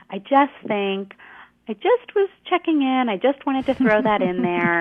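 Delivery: tentative, with rising pitch; hedging, apologetic. Shown as a demonstration. Coach showing how women hedge: apologetic, tentative. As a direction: sound small and sorry with each just. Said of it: "Things like just".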